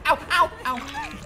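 high, loud, slow ows; mock pain yelps. Streamer yelps in mock pain while being healed. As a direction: wail each ow for show. Hit again.

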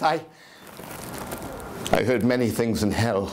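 nervous, laughing madman confessing; unhinged narrator. Acted. Madman telling listeners his story, nervous and laughing. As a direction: insist you are sane while cracking.

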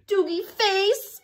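high, drawn-out nonsense finish; silly sounds. Acted. Reader ends the silly sounds for kids, high goofy flourish. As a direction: cap the gibberish with a slow goofy flourish. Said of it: "say the silly sounds".

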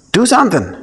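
high, pleading do something; a hint of a pushy mother; mock pleading Mary. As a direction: whine it up as the nagging mother. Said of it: "And I'm kinda like".

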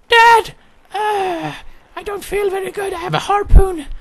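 put-on very high, halting child voice; wounded little son. Son telling Dad about his harpoon, high and feeble. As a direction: whimper in a squeaky kid's falsetto.

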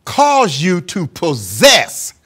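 slow, ringing preacher cadence; sermon delivery building in fervor; preaching. Acted. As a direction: preach it out, drawing each phrase long. Preaching at full force.

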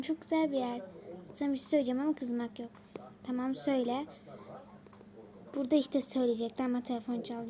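normal talking voice

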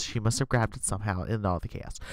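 normal talking voice

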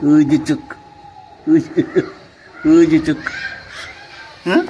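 put-on very high, loud baby talk; high coaxing pet voice. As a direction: coo at the cat to play. Coaxing the cat to play.

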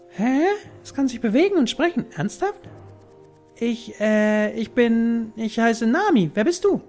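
put-on high, surprised girl voice; young girl voice. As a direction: play a startled little girl.

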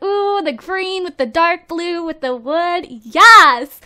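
a gushing ooh then a loud yelled yes; retold delight. Telling viewers how excited she was building it. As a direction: relive the thrill of picking colours. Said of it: "I was like".

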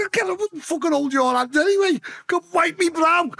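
high, urgent retold yell; exasperated yelled order. Ordering Dave to help during CPR, exasperated high yell. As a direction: shout orders over the panic.

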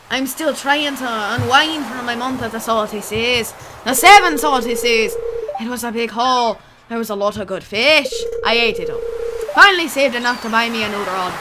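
put-on old pirate spinning a yarn; pirate sailor voice; pirate accent. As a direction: talk like a salty pirate fisherman. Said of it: "give him a pirate accent now".

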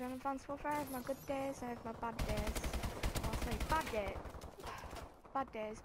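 normal talking voice

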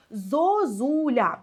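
зозуля drawn out very slowly, the stress on the middle зУ; slow stress demo. Shown as a demonstration. Teacher slowly showing students the stress in зозуля. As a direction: stretch зозуля slowly syllable by syllable. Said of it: "Як падає наш наголос?".

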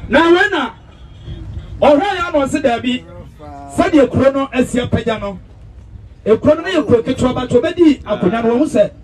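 high-pitched proclamation to a crowd; formal public address. Acted. MC addresses the gathering about the town, high-pitched proclamation. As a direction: project it high to the whole gathering.

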